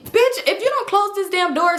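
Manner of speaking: high rising furious demand; mock angry yell. Drunk girl yelling at Leslie in the elevator, high angry. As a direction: yell at her to shut the door.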